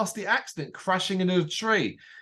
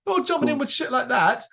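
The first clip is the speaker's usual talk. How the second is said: laughing; 11 semitones higher than usual. Real, not acted. Laughing agreement with Frank.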